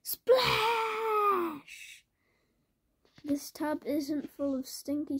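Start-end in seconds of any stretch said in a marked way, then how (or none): 0.0-2.0 high, loud cry of "splash"; excited shout. Acted. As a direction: shout it as he hits the water. Bear leaps into the tub.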